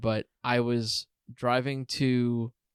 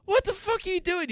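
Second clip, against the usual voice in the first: shocked high outburst. Retold or imitated. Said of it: "I was like".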